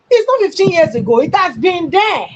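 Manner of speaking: real indignant outburst; heated rebuttal. Real, not acted. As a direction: push back loudly, pitch jumping up. Heatedly rebutting the fifteen-year claim.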